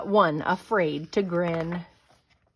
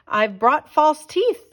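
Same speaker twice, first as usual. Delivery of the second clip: higher, louder, slow call through a door; 7 semitones higher, 6 dB louder than usual. A narrator's reading. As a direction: call it from outside. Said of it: "the dentist called".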